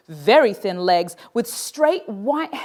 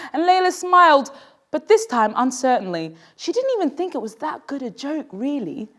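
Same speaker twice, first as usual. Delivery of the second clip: usual speaking voice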